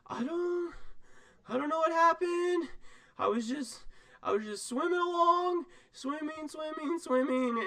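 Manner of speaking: high, small scared creature voice; a tiny lost voice, bewildered; frightened little squid. Acted. Squid telling the player how it ended up in the bottle, high and frightened. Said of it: "it says".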